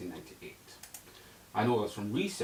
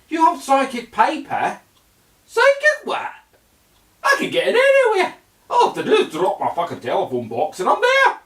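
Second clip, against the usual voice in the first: high, loud excited Scottish voice; shouting put-on companion; Doctor Who companion voice; 13 semitones higher, 11 dB louder than usual. Companion complaining to the Doctor about the coffee shop, loud put-on voice.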